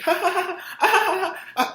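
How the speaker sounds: high, loud put-on laugh; imitated laughter. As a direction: cackle like a delighted crowd. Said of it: "to where they're like".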